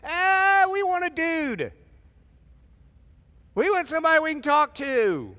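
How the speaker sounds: high, loud whining crowd voice; whiny Israelites. Acted. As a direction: beg for a dude like spoiled kids.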